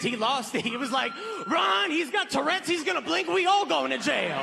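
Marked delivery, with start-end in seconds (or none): 1.5-4.4 much higher, frantic mock panic; imitating a panicked friend in a high voice. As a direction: squeal it like you're about to get arrested. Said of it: "He panicked".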